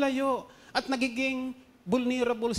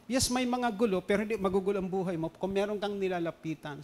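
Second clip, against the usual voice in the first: usual speaking voice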